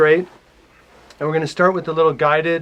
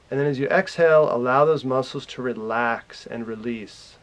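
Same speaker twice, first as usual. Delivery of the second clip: calm meditation guide, growing softer and lower; guided meditation; 3 semitones lower, 7 dB quieter than usual. Real, not acted. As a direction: lead them gently through relaxing, with long pauses. Leads a guided relaxation.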